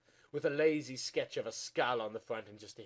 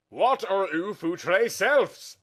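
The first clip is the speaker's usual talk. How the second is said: odd voice; 8 semitones higher, 10 dB louder, much slower than usual. Acted. Odd slow high voiced line.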